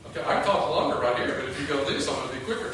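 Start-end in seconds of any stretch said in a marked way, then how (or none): none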